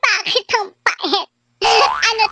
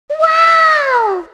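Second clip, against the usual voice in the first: high shouted wow; loud surprised shout; 9 semitones higher, 6 dB louder than usual. Acted. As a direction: shout it in shock. Exclaims at betrayal.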